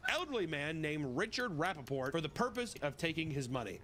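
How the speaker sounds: ordinary talk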